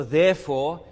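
"therefore" hit loud; stressed therefore. Shown as a demonstration. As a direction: punch the word "therefore" hard. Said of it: "is there for in verse twelve".

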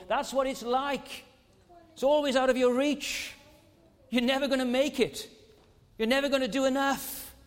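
rising pulpit fervour; preaching. Acted. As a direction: drive it home from the pulpit. Preaching at full force.

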